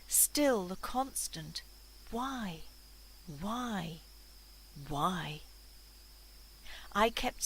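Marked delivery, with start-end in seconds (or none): 2.1-5.4 a touch of despair, each why set apart; quietly despairing, questioning lament; understated despair. A narrator's reading. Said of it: "My thoughts so full of sadness, despair".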